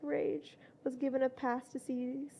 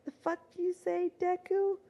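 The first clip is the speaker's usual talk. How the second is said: higher-pitched, restrained angry yell; angry scream; 5 semitones higher, 3 dB louder than usual. A narrator's reading. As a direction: snap angrily at a rival, pitched up. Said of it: "the angry Pomeranian finally screamed".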